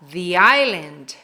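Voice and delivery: "the island" slowly, as "thee island"; long ee in "the" before "island". Shown as a demonstration. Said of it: "tem som de vogal".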